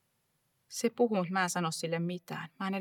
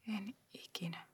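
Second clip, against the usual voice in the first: soft whispered storytelling, barely voiced; a child whispering itself to sleep in mother's arms; hushed whisper; 4 dB quieter than usual. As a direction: breathe the words like a secret. Whispered lullaby-like memory.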